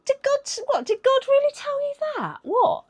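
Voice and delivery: high, sly questioning snake voice; mock the snake with a high, teasing voice; snake's voice. The snake questioning Eve, in a high sly voice. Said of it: "the snake goes".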